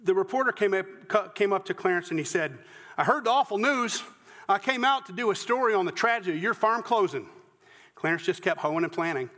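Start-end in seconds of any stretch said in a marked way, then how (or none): 3.0-7.3 higher-pitched down-home Southern voice; less sophisticated Southern accent. Acted. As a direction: play a local reporter with a country Southern accent. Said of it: "I do the other, less sophisticated".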